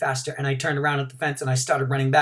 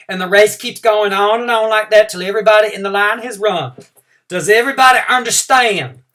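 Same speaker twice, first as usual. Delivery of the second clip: higher, louder teacher voice; teacher explaining; 7 semitones higher, 9 dB louder than usual. Acted. Teacher telling the kindergartners the race rules, loud and high.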